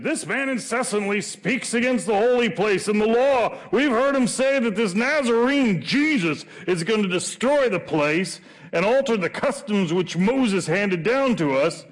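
put-on high, loud Southern accent accusation; hammed-up Southern false witness. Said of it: "why they had a southern accent".

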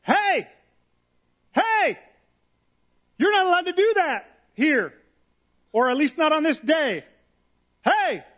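high, loud indignant yelling; angry shout. Retold or imitated. Religious leader yelling at the crowd to stop the healing, high and loud. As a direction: bark it out like an outraged official. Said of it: "the religious leader in there goes".

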